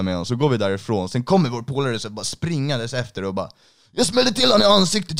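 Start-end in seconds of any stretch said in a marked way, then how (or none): 3.9-5.2 much higher and louder, breathless boast; excited bragging. Retold or imitated. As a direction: blurt it out, thrilled with yourself. Friend bragging after running away.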